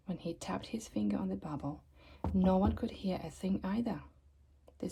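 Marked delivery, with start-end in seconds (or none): 0.1-1.8 soft unvoiced whisper; hushed narration. A narrator's reading. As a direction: breathe the words softly.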